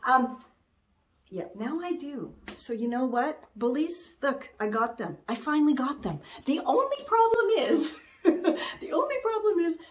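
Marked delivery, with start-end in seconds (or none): none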